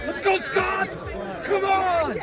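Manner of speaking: shouting encouragement. Real, not acted. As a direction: yell across the pitch. Sideline cheering at rugby match.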